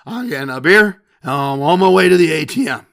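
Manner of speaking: put-on loud, slurred drunk voice; drunk husband. Drunk husband telling his wife he's off to the ATM, loud slur. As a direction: imitate a husband stumbling up for a beer, loudly. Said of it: "husband stumbles to the bar".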